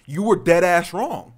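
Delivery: heated, emphatic telling-off; angry scolding. Retold or imitated. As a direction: berate them, leaning hard on each word. Scolding the driver.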